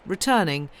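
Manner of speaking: usual speaking voice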